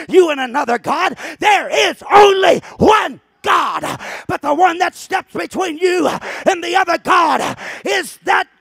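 loud, slow climactic preaching; preaching at full force. Acted. Preacher shouting to the church at full force. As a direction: thunder it to the congregation.

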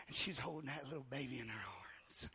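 whispered, tender narration. Acted. Preacher picturing Mary holding the baby, whispered. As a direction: breathe it like a secret.

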